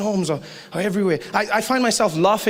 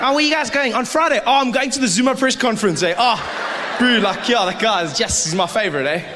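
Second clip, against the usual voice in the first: excited fan; 7 semitones higher, 3 dB louder than usual. Retold or imitated. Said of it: "then you'll be like".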